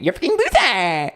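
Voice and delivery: high, distorted screech of an insult; theatrical high-pitched outburst; put-on HRH voice. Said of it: "be in my H R H for a second".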